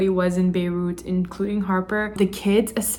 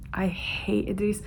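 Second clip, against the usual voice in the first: angry whisper; 5 dB quieter than usual. Real, not acted. Furious reader vents about character.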